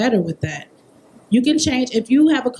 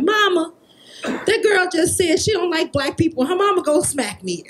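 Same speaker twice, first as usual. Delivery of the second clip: put-on child's voice; 7 semitones higher than usual. Daughter running to mom.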